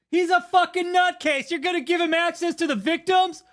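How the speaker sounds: loud, high-pitched angry outburst; real outrage, voice jumping up high; outraged. Real, not acted. Streamer rants about access to the victims, outraged.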